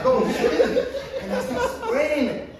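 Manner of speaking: high, loud, slow laughing words; words squeezed out through laughter; laughing hard. Real, not acted. Telling how he sprayed the fire, laughing hard.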